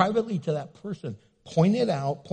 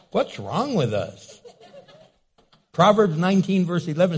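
normal talking voice

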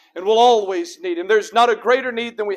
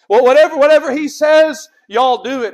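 loud, raised voice of command; forceful preacher's restatement; emphatic command; 5 semitones higher, 9 dB louder than usual. Acted. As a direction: punch the order out from the pulpit. Repeats Mary's order with force.

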